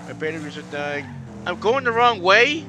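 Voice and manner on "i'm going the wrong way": high, loud, drawn-out question; incredulous yell. Real, not acted. Racer told of wrong direction, incredulous yell. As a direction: cry out in disbelief.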